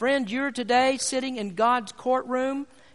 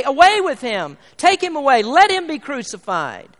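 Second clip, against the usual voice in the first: much louder, higher cry of a jeering crowd; mock crowd shout; 5 semitones higher, 10 dB louder than usual. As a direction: shout "Away with him" as the mob. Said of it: "you say".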